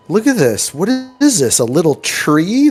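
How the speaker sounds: high, wondering voice at a tiny tree; a hint of a dopey villager; mock dumb townsfolk. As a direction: marvel like a simpleton. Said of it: "they're like".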